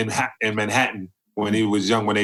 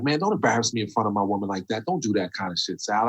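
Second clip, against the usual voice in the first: hushed scolding; 5 dB quieter, faster than usual. Retold or imitated. Friend embarrassed in front of his woman, hushed scolding. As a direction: quietly retell a friend's annoyed plea. Friend retold telling him off privately.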